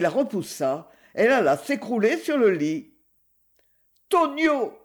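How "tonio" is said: high-pitched call of his name; crying out. A narrator's reading. Wife crying out her husband's name, high and sharp. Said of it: "cria-t-elle".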